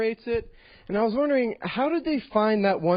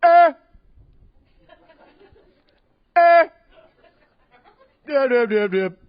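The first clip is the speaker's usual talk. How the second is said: loud, higher grunting ad-libs; 7 semitones higher, 9 dB louder than usual. Retold or imitated. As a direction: grunt the beat fillers like a producer. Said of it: "this is my impression of [name]".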